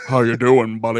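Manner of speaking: mock bro greeting.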